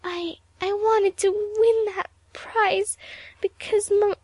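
high, halting plea with stammered starts; crumpling. A narrator's reading. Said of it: "muttered Evelyn".

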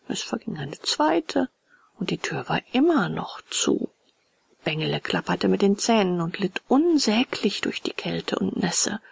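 plain reading voice

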